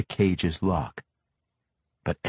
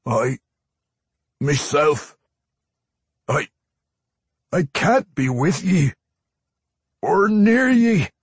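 pleading, high and loud, halting; desperate dwarf struggling with himself; Pwent; 10 semitones higher, 8 dB louder than usual. A narrator's reading. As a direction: plead in broken bursts as a tormented dwarf. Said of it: "he said in a pleading tone".

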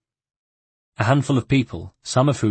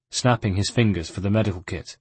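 usual speaking voice

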